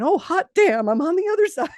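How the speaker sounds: laughing, overjoyed discovery; excited exclamation. Retold or imitated. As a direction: burst out in giddy triumph, laughing. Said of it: "inches from saying".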